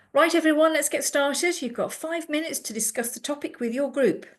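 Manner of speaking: rallying the class like a teacher. Retold or imitated. Teacher calling a class to order, brisk.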